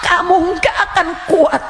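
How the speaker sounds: loud, high, final blow; shouted warning. Acted. Preacher warning listeners about hell, loud shout. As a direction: hurl the warning at them.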